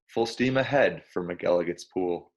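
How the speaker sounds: ordinary delivery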